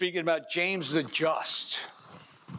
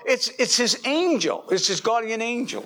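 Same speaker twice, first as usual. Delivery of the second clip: louder, higher hurried insistence; excited exclaiming; 7 semitones higher, 5 dB louder than usual. Acted. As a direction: blurt it out in alarm. Disciples guessing who knocks.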